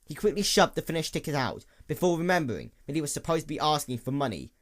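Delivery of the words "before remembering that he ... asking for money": ordinary delivery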